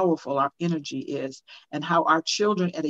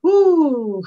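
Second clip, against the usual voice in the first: high, loud joyful woo; delighted loud whoop; excited whoop; 10 semitones higher, 9 dB louder than usual. Real, not acted. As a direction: cheer the topic like it's your favourite. Excited reply to topic soothed.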